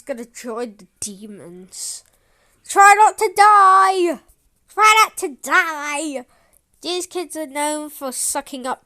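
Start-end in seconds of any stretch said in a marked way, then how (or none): 2.7-6.3 loud warning shout. Pretend. Warning cartoon character.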